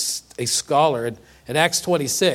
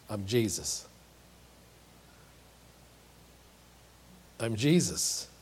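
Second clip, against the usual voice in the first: quiet answer; 6 semitones lower, 9 dB quieter than usual. Retold or imitated. Voicing Jesus's answer softly.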